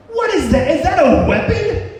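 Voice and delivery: high, loud alarmed demand; alarmed security guard. Retold or imitated. Guard at the scanner demands answers, high and alarmed. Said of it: "And she said".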